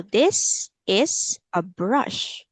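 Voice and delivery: very slow, word by word for a learner; teacher's slow repeat-after-me delivery; slow model sentence. Shown as a demonstration. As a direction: draw out each word for repeating.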